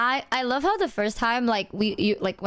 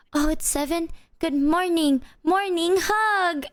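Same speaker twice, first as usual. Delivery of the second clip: high, cooing morning hug plea, slowing at the end; sweet wheedling; 7 semitones higher, slower than usual. Retold or imitated. Boyfriend imitating himself coaxing girlfriend into a morning hug, high cooing. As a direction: coax for a cuddle like a sleepy child. Quoting own sleepy wake-up coaxing.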